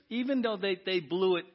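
ordinary delivery

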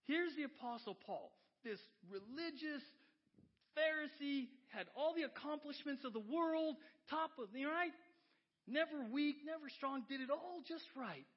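high, loud, impassioned pulpit delivery; fired-up preacher; full-force preaching. Acted. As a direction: drive it home at full voice.

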